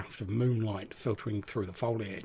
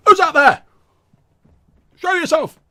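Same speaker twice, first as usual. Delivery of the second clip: high, loud challenging shout into the dark; 14 dB louder than usual. Acted. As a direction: bellow it at an unseen intruder. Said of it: "Garbett shouting".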